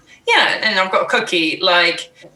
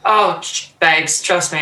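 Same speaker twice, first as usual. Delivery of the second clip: ordinary delivery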